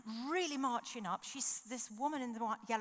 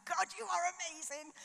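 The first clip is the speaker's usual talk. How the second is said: excited praise; 10 semitones higher, 5 dB louder than usual. Retold or imitated. Thrilled at finding Christians.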